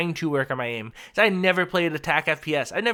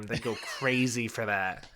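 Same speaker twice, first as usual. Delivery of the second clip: ordinary talk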